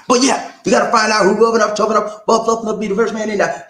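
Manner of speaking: rally the crowd, high and punchy; hype call-out. Real, not acted. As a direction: pump up the room.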